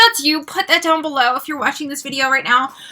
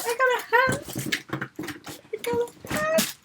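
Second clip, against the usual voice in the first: tiny, high, soft squeak; overcome with delight; 9 semitones higher, 9 dB quieter than usual. Real, not acted. Fan who got a hat, overcome hushed squeal.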